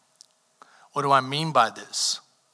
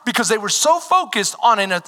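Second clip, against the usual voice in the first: preaching with rising heat, higher; impassioned preaching; 6 semitones higher, 5 dB louder than usual. Acted. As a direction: press the point hard. Preaching at full force again.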